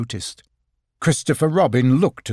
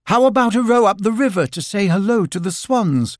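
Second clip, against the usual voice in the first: high, raised coaxing call; calling through a door; 8 semitones higher, 3 dB louder than usual. Acted. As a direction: coax someone behind a door.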